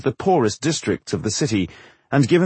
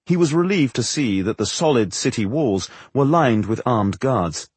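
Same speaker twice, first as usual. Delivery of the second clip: ordinary delivery, nothing marked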